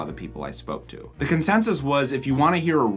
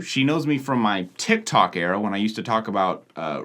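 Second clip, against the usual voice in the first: usual speaking voice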